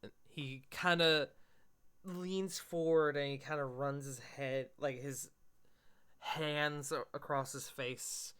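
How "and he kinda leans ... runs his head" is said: normal talking voice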